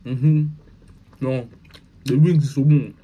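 chewing while talking; talking between bites; mouth full. Real, not acted. Seen 2: Bites wing at -3; closed, working mouth while talking, holding wing.

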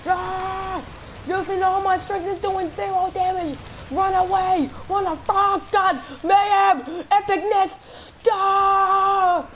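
very high, loud battle hollering; frantic yelling. Pretend. Gamer doing zero damage and running away, frantic high yelling. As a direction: scream it out in over-the-top panic. Mock panic yelling in boss fight.

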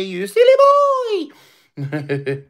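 high, loud squeal on silly; playful squeal. Acted. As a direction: tease the cat in a high squeal. Teasing the cat lovingly.

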